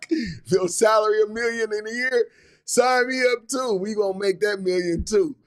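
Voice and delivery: high-pitched, riding out of a laugh; amused excitement. Real, not acted. Coach begging in on a million salary, amused and excited. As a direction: exclaim it, still tickled by the joke.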